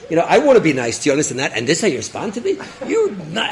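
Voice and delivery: mildly heated, rising indignation; angry scolding. Acted. Officer telling off the rabbi, angry scolding. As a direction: scold him, getting worked up. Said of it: "he starts screaming at him".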